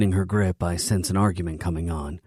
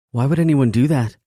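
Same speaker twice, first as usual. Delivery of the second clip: lifted, louder woman's voice; higher female voice; 8 semitones higher, 8 dB louder than usual. A narrator's reading. Female character question, high and loud.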